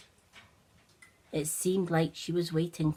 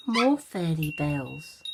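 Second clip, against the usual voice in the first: plain reading voice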